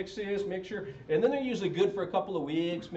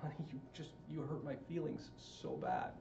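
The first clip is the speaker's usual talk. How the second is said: very soft, wounded confession; hushed, pretend vulnerability; mock hurt husband; 12 dB quieter than usual. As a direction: murmur it tenderly to a spouse. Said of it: "rather than telling our spouse".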